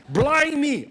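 loud, high burst of surprise; startled exclamation. Acted. Sleeper soaked with cold water, crying out loudly in shock. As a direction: blurt it like you've just been soaked.

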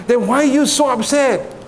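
high-pitched, puzzled demand; exasperated question, pitched well up; baffled exasperation. Acted. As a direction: act the friend who can't make sense of it. Friend's baffled question in joke.

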